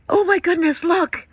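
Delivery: high, loud amazed cry; thrilled exclamation at a bird overhead; excited. Real, not acted. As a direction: gasp out in real wonder. Sees condor fly over.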